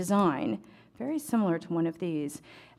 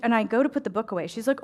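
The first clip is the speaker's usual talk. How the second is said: ordinary talk, nothing marked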